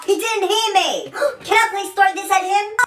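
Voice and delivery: ordinary delivery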